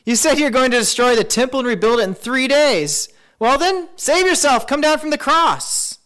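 high, loud jeering; taunting yell at full height; shouted mockery. A narrator's reading. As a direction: hurl it as abuse from the crowd. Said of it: "shouted abuse, shaking their heads in mockery".

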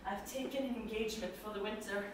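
quiet resigned answer to Treplev; hushed. Acted. Resigned reply about leaving.

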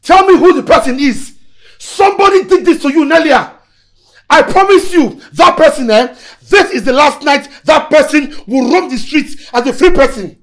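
loud, high-pitched angry demand; outraged vow. Acted. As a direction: thunder threats on her behalf. Boyfriend vows to punish her attacker.

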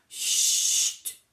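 sharp, loud shhh; loud shush. A narrator's reading. A stranger hushing the noisy bear, loud shush. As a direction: silence them with a hiss. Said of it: "fit une voix".

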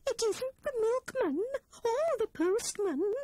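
high little squirrel voice, worried; anxious Squirrel. A narrator's reading. Squirrel ruling out visitors to her friends, high and worried. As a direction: fret like a small frightened animal.

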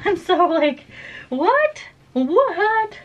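high-pitched, disbelieving "what?"; squeaky disbelief. Real, not acted. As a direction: squeal it in total shock. Can't get makeup off.

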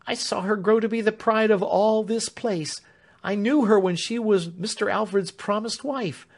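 high, earnest begging woman; emotional plea. A narrator's reading. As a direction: beg him, pitched high and heartfelt. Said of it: "catching in her emotion at Mister Warden's cloak".

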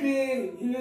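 ordinary delivery

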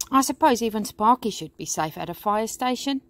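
usual speaking voice

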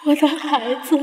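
anguished, tearful calling; crying. Acted. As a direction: call out through sobs. Mother crying for lost baby.

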